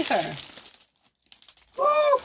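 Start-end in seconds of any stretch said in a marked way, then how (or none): 1.8-2.2 high loud whoop of joy; loud high woo; excited woo. Real, not acted. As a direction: whoop at the coat. Reacting to the coat.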